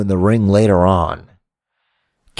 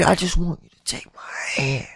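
softer, eerie whispered plea; creepy whisper; 5 semitones higher, 8 dB quieter than usual. Acted. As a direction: whisper the invitation. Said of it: "I gotta get in some Bray Wyatt mode".

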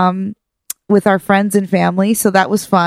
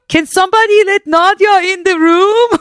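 high-pitched hollering dad, amused; imitating dad; 11 semitones higher, 3 dB louder than usual. Dad on speaker asking the family for help, imitated high holler. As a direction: call it across the room as a fussing dad. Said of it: "he's got me on speaker and he's like".

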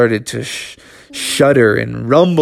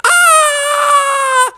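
high yelp. Acted. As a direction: yelp as your tail burns. Tails lit on fire.

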